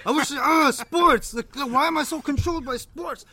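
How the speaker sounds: high, loud mock exasperation; imitating self-directed anger. Retelling friend's rant at himself, mock exasperated. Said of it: "getting mad at himself".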